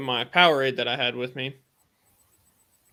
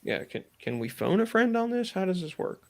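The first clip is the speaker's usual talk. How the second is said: playful joking; 6 semitones higher than usual. Real, not acted. Jokes about phoning a friend.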